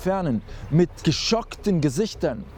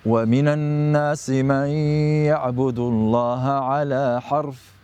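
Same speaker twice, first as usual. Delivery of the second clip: fast, louder chanted recitation; Quran recitation; 4 dB louder, much faster than usual. Acted. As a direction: recite the verse in tajwid style. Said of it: "in den folgenden Worten beschreibt".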